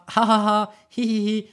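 spoken "ha ha ha, he he he"; demonstrated polite laugh. Shown as a demonstration. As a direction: show a tidy, deliberate laugh. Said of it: "to laugh".

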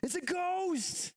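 high, slow drawn-out panicked cry; terrified shriek. Acted. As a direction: wail it like you just saw a ghost. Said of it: "Sorry, Reese, if you're sleeping".

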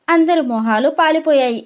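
ordinary delivery

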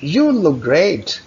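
slow, slightly excited "You look great"; excited praise. Shown as a demonstration. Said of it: "थोड़ा सा ज़रा excited हो". (थोड़े उत्साह से तारीफ)

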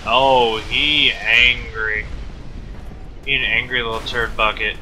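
loud, slow mock announcement that the monster is furious; mock alarm. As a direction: drag it out loudly, teasing. Mocking enraged monster loudly.

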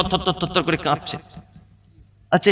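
ordinary talk

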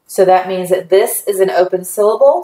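ordinary talk

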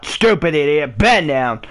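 low, exasperated scolding; fed-up insult and order; upset. Real, not acted. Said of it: "Sorry, I'm getting upset".

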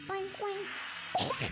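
high, soft play oinks; pig oinks. Pretend. Child playing a pig in a game, high soft oinking. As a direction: oink softly like a little pig. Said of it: "I'm a pig".

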